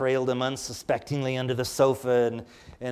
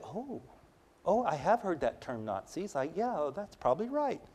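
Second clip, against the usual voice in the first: put-on softer, sheepish little boy; meek reply; 4 semitones higher, 8 dB quieter than usual. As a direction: mumble it like a corrected child. Boy meekly accepting correction.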